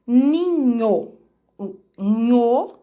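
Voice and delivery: "ninho", then "nho" said slowly and drawn out; demonstrating NH. Shown as a demonstration. Teacher modelling the NH digraph in ninho. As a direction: linger on the "nho" sound. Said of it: "O N H".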